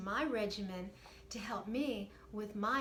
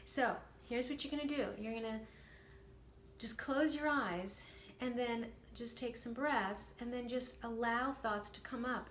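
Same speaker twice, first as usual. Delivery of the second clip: usual speaking voice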